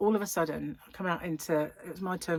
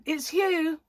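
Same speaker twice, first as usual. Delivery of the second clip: imitating Dave; 11 semitones higher, 5 dB louder than usual. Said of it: "Or he'd say".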